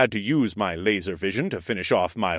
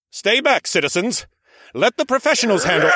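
loud, much higher, fast call; heroic announcement; 14 semitones higher, 5 dB louder than usual. A narrator's reading. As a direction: address the crowd like a hero. Hero calls out to crowd.

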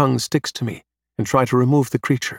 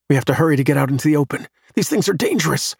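rushed urging, rising high at the end; urgent; 4 semitones higher, faster than usual. A narrator's reading. Adam hurries Eve out into the open, urgent. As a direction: hurry a companion along in alarm.

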